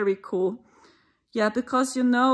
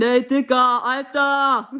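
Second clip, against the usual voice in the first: louder, swaggering street-kid mock voice; German gangster slang; 3 semitones higher, 7 dB louder than usual. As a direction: ham up a German teen rapper greeting his mate. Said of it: "This is how the, the Germans talk".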